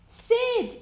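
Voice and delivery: high mocking shout of the name; teasing yell. A narrator's reading. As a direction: jeer it out loud and high. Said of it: "yelled all the toys at once".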